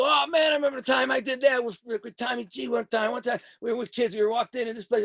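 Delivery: high-pitched, excited mimicry of old pals; animated reminiscing. Mobsters trading their own fight stories, animated imitation. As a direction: burst in high, eager to top the story. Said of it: "they're all animated and jumping around".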